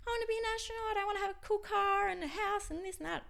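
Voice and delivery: put-on high, loud, childlike gush; mock little kid voice. An adult mimicking a kid listing dreams, high and loud. Imitating small kids' dreams.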